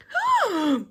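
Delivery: sharp high gasp of delight; a thrilled storyteller's gasp. Acted. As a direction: gasp as if the floor dropped away. Narrator gasps at the loop.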